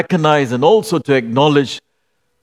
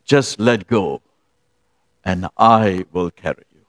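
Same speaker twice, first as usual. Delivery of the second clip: gentle reassurance; 8 semitones lower than usual. Retold or imitated. God gently reassures the man.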